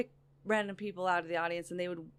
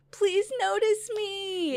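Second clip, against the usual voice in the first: high, slow, pleading mock voice; pleading for attention; 12 semitones higher, 6 dB louder, much slower than usual. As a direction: beg to be noticed, hammed up. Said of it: "vying for attention".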